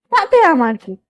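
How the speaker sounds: usual speaking voice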